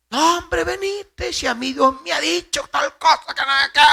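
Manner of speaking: high-pitched, hurried mock persuader; coaxing tempter. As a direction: wheedle it in a high, quick voice. Acts the other prophet coaxing.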